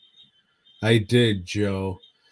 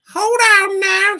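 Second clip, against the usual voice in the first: exaggerated Southern mammy voice; 11 dB louder than usual. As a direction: squeal it out like a cartoon caricature. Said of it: "She didn't talk like that".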